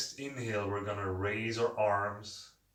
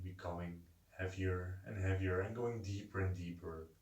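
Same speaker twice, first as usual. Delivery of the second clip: guided meditation. Acted. Leads a seated breathing meditation.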